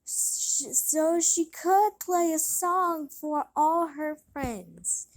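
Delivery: ordinary delivery, nothing marked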